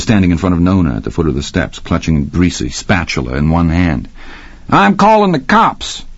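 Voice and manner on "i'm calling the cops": angry cook. A narrator's reading. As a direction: threaten to call the police. Cook threatening the attacker.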